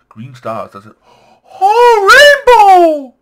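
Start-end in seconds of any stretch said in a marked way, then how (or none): 1.6-3.1 loud, very high squeal of delight; overjoyed shriek. Real, not acted. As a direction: shriek it out, stretched and thrilled. Sudden sight of a rainbow.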